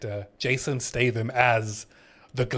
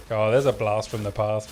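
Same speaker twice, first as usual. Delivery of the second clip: ordinary talk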